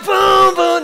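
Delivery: high, loud triumphant cry. Real, not acted. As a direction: celebrate walking free. Set free, celebrating.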